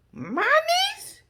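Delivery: very high-pitched, hammed-up disbelief; mock shock. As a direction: squeal it as an imitation of shocked disbelief. Acts out Miss Wanda's shocked reply.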